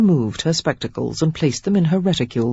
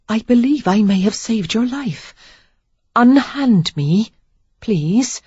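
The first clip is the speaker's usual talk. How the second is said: put-on flustered young lady; 6 semitones higher, 3 dB louder than usual. A narrator's reading. Sarah pleads, trapped in his grip.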